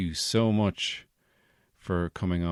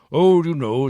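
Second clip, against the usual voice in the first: Santa voice; 7 semitones higher, 10 dB louder than usual. Acted.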